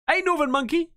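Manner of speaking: calling out. Real, not acted. Greeting a donor by name.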